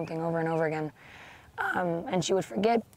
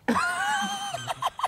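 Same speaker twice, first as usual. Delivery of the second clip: high laugh shout. Real, not acted. Reacting to being the one.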